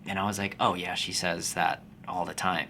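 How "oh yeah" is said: ordinary talk, nothing marked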